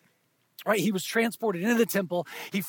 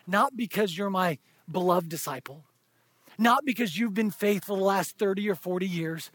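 ordinary delivery

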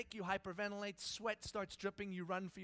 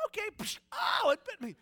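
much higher and louder hammed-up yell; mock yelp; 12 semitones higher, 11 dB louder than usual. Moses yelping as the snake bites him. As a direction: shout like the snake just bit you. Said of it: "Does God just go".

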